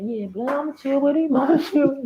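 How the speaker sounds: high sly voice of a fake-friendly homie; sly agreement. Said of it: "He said".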